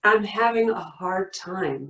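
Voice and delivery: normal talking voice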